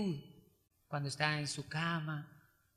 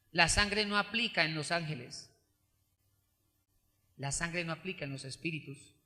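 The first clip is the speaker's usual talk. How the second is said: ordinary delivery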